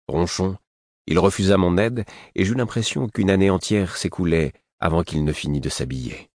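ordinary delivery

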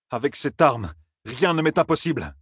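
a high, strained, defiant cry; defiant Rand. A narrator's reading. Rand defies Moiraine, a high defiant cry. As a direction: fling it back at her, trembling with rage. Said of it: "lança Rand".

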